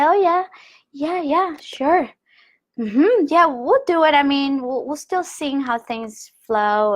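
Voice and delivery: high, hollow yes-yes to mom; fake agreement. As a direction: brush mom off with forced cheer. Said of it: "I would say".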